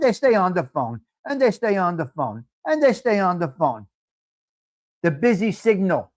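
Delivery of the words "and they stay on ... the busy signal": normal talking voice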